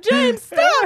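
a hammed-up cry for him to stop; mock yell. Relatives shouting at the drunk uncle on the ice, mock yell.

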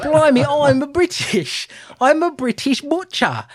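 loud, high, slow hammed-up Cockney; mock British accent. Co-host mocks the film's butcher, exaggerated British accent. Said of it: "going for a British accent".

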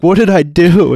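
high, loud plea of innocence; exclaimed, incredulous question; retold indignant protest. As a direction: act out outraged bewilderment.

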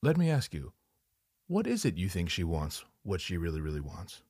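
deep, soft therapist voice, calm and probing. Retold or imitated. The therapist turns to the man, a deep, soft counsellor voice. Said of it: "Then the therapist interjects".

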